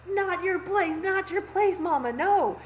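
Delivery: high, loud scolding outburst; acted loud protest. Retelling a protest at mom's carb comment, loud indignation. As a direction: shut it down, emphatic and high-pitched.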